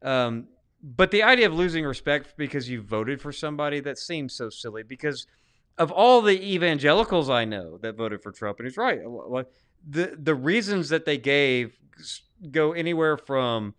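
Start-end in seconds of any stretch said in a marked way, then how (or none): none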